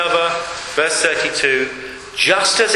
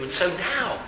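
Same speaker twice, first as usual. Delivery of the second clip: ordinary talk, nothing marked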